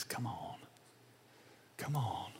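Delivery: very soft, near-whispered coaxing; hushed invitation. Acted. Jesus beckons the burdened and weary, hushed invitation. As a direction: coax them in under your breath.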